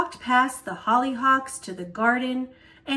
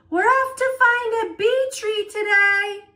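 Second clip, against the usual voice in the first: high, loud excited shout; 11 semitones higher, 6 dB louder than usual. A narrator's reading. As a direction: Grandpa yelling to a neighbour while running past. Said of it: "Grandpa shouted".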